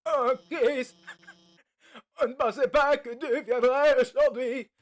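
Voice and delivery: voix de personnage plus haute et plus forte, en riant; personnage surpris. Acted. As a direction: accueille Chris, étonné et amusé. Personnage qui accueille Chris. (voix aiguë d'un camarade surpris)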